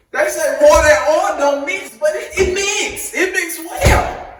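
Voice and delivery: high-pitched, hyped-up urging; playful egging on. Pretend. Host urging viewers to pour freely, hyped-up egging on. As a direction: cheer them on in a high, stretched voice.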